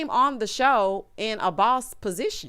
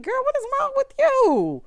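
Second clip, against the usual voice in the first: much higher, louder disbelieving cry; incredulous squeal; 3 dB louder than usual. Retold or imitated. Recapping her own reaction to the fight, a high incredulous shriek. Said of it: "I'm like".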